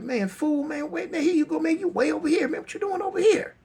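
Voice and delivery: very high, agitated buddy voice; excited homeboy. Retold or imitated. Homeboy finding Trey Rock, calling out excitedly high. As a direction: imitate a friend calling out in high pitch. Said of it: "one of his homeboys like".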